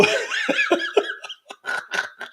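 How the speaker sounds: high, wheezy laughing fit; long, helpless high laugh; real burst of laughter. Real, not acted. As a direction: lose it completely in high giggles. Cracks up at a comment.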